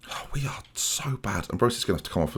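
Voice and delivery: hushed groan of despair; despairing whisper. Real, not acted. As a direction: breathe it out in defeat. Despair at losing match.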